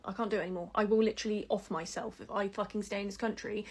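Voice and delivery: ordinary delivery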